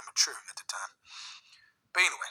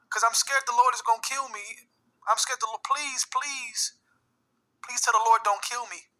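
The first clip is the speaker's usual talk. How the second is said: scared pleading; 9 semitones higher, 4 dB louder than usual. Retold or imitated. Frightened mother pleads, imitated.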